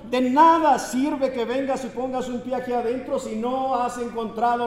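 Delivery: high, loud fiery preaching; impassioned street preacher on the microphones; preaching with fervor. Retold or imitated. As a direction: preach it hard to the crowd with zeal. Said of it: "comenzó a predicar con ahínco, con denuedo".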